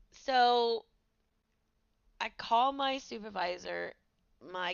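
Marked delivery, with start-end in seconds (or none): none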